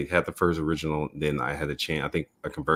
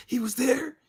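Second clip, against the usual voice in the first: surprised disbelief; slower than usual. Retold or imitated. Recalling seeing the pictures.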